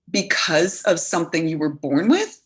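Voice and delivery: ordinary delivery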